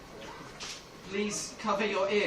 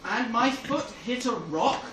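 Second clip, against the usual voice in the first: normal talking voice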